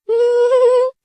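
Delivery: a high, silly giggle. Acted. Daughter-in-law laughs at wrong time. (ऊँची खिलखिलाहट)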